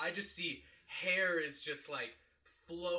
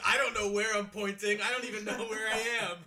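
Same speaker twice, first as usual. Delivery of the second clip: laughing drunk imitation; 7 semitones higher, 9 dB louder, faster than usual. Teasing friend, imitating him drunk.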